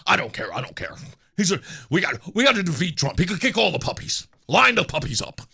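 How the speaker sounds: very low, dopey supporter voice; mock supporter. Mocking a voter who'd excuse anything, low and dopey. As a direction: drone it low like a clueless partisan. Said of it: "Just shut up".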